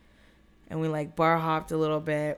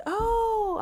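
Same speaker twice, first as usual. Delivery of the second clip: retold high-pitched "oh" of embarrassment; embarrassed; 3 dB louder than usual. Woman realizes she fell asleep on a date, embarrassed. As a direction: act out a squeaky, sheepish realization. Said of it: "I was embarrassed".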